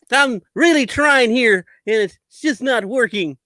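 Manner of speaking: gee-whiz innocent tone; fake wholesome. Hunter Biden parody voice.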